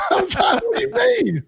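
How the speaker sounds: high, laughing retelling of a protest. Real, not acted. Player laughing as he retells being pulled from the game. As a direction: laughs through the memory of being subbed off.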